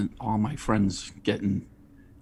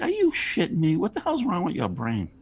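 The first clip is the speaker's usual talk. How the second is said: higher, exasperated mock outburst; mock disbelief; 10 semitones higher than usual. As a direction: play the scoffing vendor. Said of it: "It's like".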